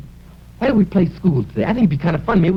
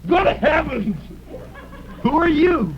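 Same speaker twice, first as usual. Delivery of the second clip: mock surprise; 8 semitones higher, slower than usual. Surprised at the costume.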